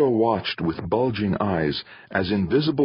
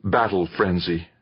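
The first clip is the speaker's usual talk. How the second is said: ordinary delivery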